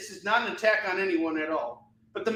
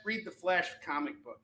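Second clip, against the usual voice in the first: ordinary talk, nothing marked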